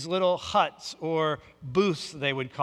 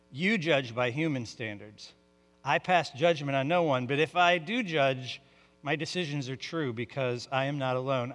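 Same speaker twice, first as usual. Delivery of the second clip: lower, measured reading of a Bible passage; scripture reading; 3 semitones lower than usual. Acted. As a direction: read the Gospel with calm weight. Reads scripture passage aloud.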